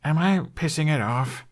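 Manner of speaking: slight wince. A narrator's reading. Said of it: "asked with a slight wince".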